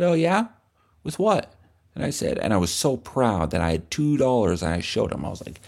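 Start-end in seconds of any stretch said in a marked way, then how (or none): 0.0-1.5 put-on pushy cool kid.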